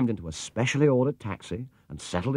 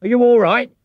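worried call, much higher and louder; called anxiously; 10 dB louder than usual. A narrator's reading. Cabbie calling to the fallen bear, anxious and loud. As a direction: call out in alarm. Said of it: "he called anxiously".

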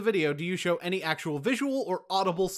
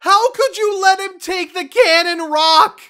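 loud, slow, high-pitched outrage; outraged yell; 14 dB louder, slower than usual. Acted. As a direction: bellow it in disbelief. Outrage over the lost rock.

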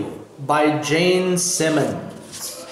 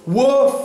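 high imitated dog woof; dog's woof; 6 semitones higher, 4 dB louder than usual. A narrator's reading. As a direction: voice a pet dog's bark, pitched up. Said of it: "said Ebb".

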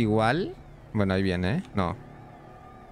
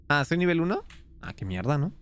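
ordinary delivery

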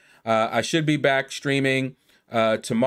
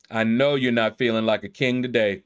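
ordinary talk

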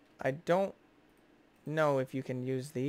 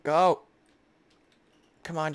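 ordinary talk, nothing marked

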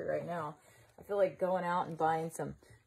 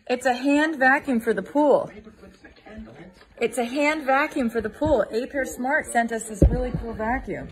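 very loud, raised, emphatic shout; calling out; 3 semitones higher, 11 dB louder than usual. Real, not acted. Vlogger telling distant Carlos about the pool vacuum, calling out. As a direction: make every word carry across a distance.

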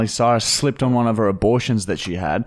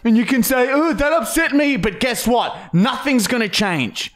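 heated rant; 10 semitones higher than usual. Real, not acted. Ranting at commenters.

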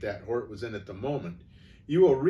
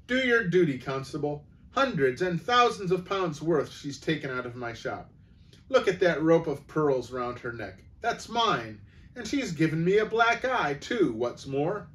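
angry shopkeeper pleading with the constable; indignant fat shopkeeper; 4 semitones higher than usual. Acted. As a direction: demand justice from the constable. Fat man complains to police.

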